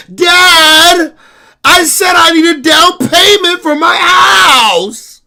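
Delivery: high shouted demand, slow and stretched; bratty daughter yelling. Retold or imitated. Daughter insisting to dad on down payment, high bratty shout. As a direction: yell it like a spoiled girl not getting her way. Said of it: "she be like".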